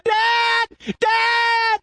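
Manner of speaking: high panicked shout for dad; high childlike shout of panic; cried out. Acted. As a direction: yell for dad like a scared little boy. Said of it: "he cried out".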